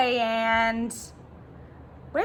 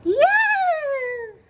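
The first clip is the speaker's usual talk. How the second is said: very high, drawn-out yes; long, high-pitched exclamation; witch's cry; 14 semitones higher, 4 dB louder than usual. A narrator's reading. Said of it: "cried the witch".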